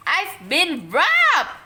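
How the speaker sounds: loud, slow high howl of outrage. A narrator's reading. Boy howling over his empty money bank. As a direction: wail it like the world ended. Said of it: "howled Horrid Henry".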